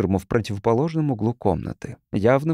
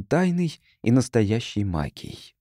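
usual speaking voice

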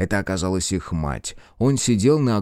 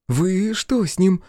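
much higher, astonished question; amazed; 12 semitones higher, 4 dB louder than usual. A narrator's reading. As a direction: gasp it out in disbelief. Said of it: "ещё сильнее удивился мальчик".

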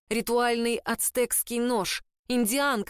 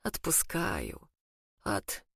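fading soft farewell; 12 semitones lower, 6 dB quieter, much slower than usual. A narrator's reading.

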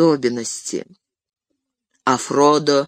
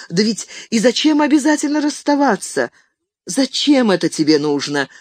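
much higher, fast outburst; sudden cry; 8 semitones higher than usual. A narrator's reading. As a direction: burst out, rattled and defensive. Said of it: "Вдруг вскрикнул он".

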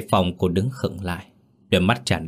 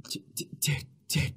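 whispered, stuttering shock; stunned stammer; 6 semitones higher, 10 dB quieter than usual. Acted. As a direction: barely get the word out, whispering. Son stunned by news of death. (lắp bắp thì thào bàng hoàng)

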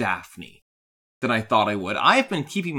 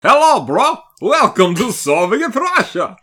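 loud, higher, hammy Eastern European welcome; Eastern European accent; 8 semitones higher, 9 dB louder than usual. Pretend. Joking host greets a guest in a loud Eastern European accent. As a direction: boom it like a cartoon Soviet host. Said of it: "why I'm going Eastern European".

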